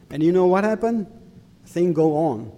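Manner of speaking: ordinary talk, nothing marked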